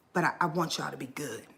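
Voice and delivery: soft, near-whispered plea; real hushed voice close to crying; holding back tears. Real, not acted. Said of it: "I'm gonna start crying".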